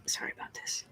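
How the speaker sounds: soft unvoiced whisper; hushed apology to a dozing cat. Real, not acted. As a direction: whisper so the sleeping cat stays asleep. Cat sleeping nearby, speaks quietly.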